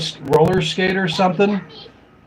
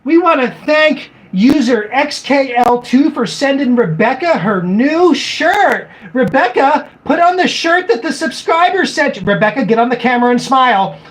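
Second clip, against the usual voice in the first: higher, sing-song parent-host voice; mock family vlogger; 6 semitones higher than usual. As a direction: imitate a vlogger parent ordering the kid on camera. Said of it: "they're like".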